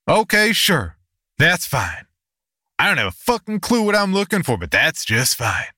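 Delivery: loud, high, sarcastic snarl; exasperated snarl. A narrator's reading. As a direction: snarl it out, fed up and bitter. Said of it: "threw my hands up in exasperation and snarled".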